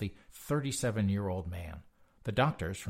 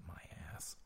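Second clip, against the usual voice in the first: muttered under the breath; soft sarcastic whisper; whispered scoff; 4 semitones lower, 19 dB quieter than usual. Real, not acted. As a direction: dismiss it in a sneer of a whisper. Dismissive whispered aside.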